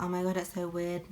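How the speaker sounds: usual speaking voice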